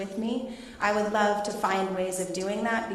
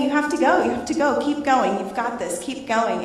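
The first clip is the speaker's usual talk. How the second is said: louder higher urging husband; lightly voiced urging husband; 4 semitones higher, 8 dB louder than usual. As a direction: coach her up the hill, higher and louder. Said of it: "he just kept saying".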